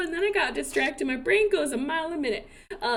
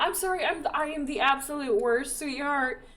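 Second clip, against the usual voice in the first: ordinary talk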